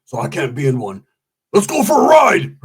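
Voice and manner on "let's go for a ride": loud, high call to hit the road; excited shout. Retold or imitated. Roach shouting to ride in the Mustang, excited. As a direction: shout it like a hyped invite.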